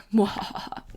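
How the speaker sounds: breathy villain mwahaha laugh; evil mwahaha. Pretend. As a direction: cackle like a cartoon villain. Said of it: "he doesn't do the mwahaha, I added that".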